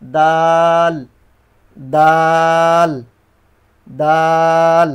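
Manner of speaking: দাল stretched into a long level tone; long drawn dal. Shown as a demonstration. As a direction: draw out দাল like a chant, each one long. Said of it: "এভাবে।".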